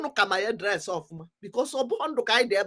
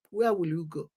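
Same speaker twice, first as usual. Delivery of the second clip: dropped low and soft, almost to himself; quiet; 6 semitones lower, 7 dB quieter than usual. Real, not acted. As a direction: let the question sink in softly. Repeated rhetorical question, second take.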